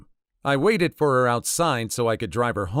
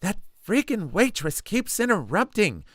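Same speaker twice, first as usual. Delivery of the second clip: whiny, higher griping voice; complaining; 8 semitones higher than usual. Retold or imitated. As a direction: gripe about the waitress, higher. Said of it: "Susie keeps complaining".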